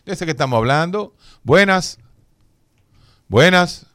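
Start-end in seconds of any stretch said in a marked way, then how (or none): 1.4-3.9 loud repeated call of greeting. Real, not acted. Greeting a silent caller on air, loud calling out. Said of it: "Está en el aire".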